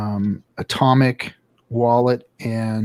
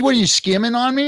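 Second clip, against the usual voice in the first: put-on high indignant kid voice; mock son accusing; 10 semitones higher than usual. Dad repeats son's accusation in a high indignant voice. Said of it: "he said".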